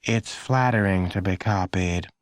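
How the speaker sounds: slow, weary mom voice; a worn-out mother sighing at the kids; a touch of a tired mom. A narrator's reading. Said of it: "the mother said in a tired voice".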